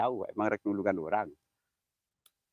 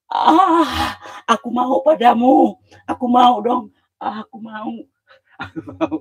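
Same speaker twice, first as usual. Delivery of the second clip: much higher and much louder, wailing; hammed-up ghost voice; 12 semitones higher, 11 dB louder, slower than usual. At the haunted well, a loud high mock ghost wail. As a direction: wail like a creepy spirit begging.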